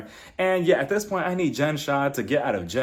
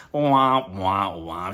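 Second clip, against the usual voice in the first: falling "wah, wah, wah"; mock a flop with descending trombone wahs; sad trombone noise; 7 semitones lower, much slower than usual. Shown as a demonstration. Mocking Brittany's failed comeback.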